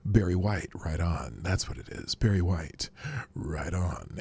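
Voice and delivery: deep, low soul-singer impression. Host imitating a soul singer for the chat, deep voice. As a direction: sink way down, laid-back and sultry. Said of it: "sometimes I can do that".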